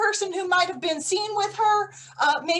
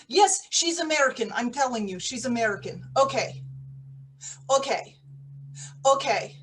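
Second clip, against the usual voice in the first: ordinary talk, nothing marked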